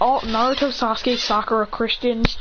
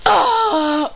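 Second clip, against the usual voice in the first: loud, high exasperated "ah"; loud sigh; 11 semitones higher, 6 dB louder than usual. Acted. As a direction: let out a big, noisy sigh. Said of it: "lets out a loud".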